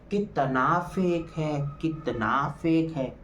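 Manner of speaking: slow drawn-out mock cry of fake; mocking Isha's outburst. Vlogger mimics Isha shouting at Ankita, slow drawn-out mock. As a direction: stretch each word like a mocking retold yell. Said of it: "खड़े होके चिल्लाने लग गई".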